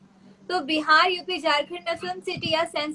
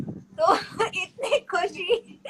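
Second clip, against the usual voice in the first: slow, higher, breaking into laughter; laughing; 5 semitones higher, much slower than usual. Real, not acted. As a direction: laugh through the question. Amused by the question she reads. (हँसते हुए)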